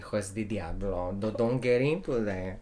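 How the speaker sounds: soft, low imitation of a Latin mom; lightly done Latin accent; mom's Latin accent. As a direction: warn like a Latin mother, accent only half there. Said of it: "I'm not doing a proper a-, um, Latin accent".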